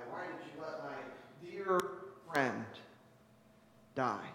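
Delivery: low, subdued, halting questions; grieving plea. Acted. Mourners asking God about their dead, subdued grief. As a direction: ask it quietly, pausing under the grief.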